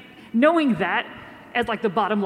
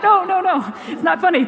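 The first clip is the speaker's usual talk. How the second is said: mock scolding; 6 semitones higher, 3 dB louder than usual. Scolding baby after reading news.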